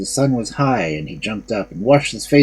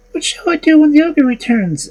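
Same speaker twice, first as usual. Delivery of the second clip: high, anxious maiden; 5 dB louder than usual. Acted. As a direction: lift to a high young woman's voice. Maiden asks.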